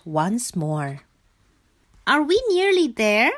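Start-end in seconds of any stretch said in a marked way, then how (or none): 2.1-3.3 high little frog asking hopefully; high-pitched question with a rising end; inquiring frog voice. A narrator's reading. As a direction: ask in a high childlike frog voice. Frog asks about the journey.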